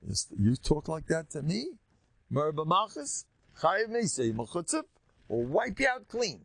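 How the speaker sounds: acted outrage, pitch climbing high; indignant threat. Offended king answering an insolent miser, indignant threat. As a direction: build from affronted question to high threat. Acting David's furious threat to Novel.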